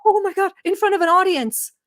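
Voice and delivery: shocked disbelief. Real, not acted. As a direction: pitch jumps way up in disbelief. Streamer reacting to clip, shocked.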